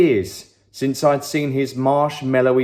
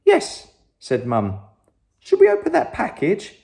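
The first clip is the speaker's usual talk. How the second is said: excited mum; 7 semitones higher than usual. Acted. Mum eager about package.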